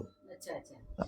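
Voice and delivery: a breathy private acknowledgment; murmured. Real, not acted. Devotee softly takes in the guru's advice, murmuring.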